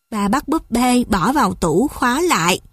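ordinary delivery

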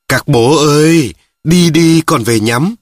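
slow, hearty holler; calling out. A narrator's reading. Rousing resting pallbearers to move.

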